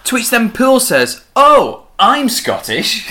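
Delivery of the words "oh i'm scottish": high, slow, exaggerated exclamation; mock delighted surprise. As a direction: act out an overjoyed discovery. Acting out Poole's delighted reaction.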